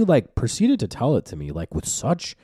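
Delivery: ordinary talk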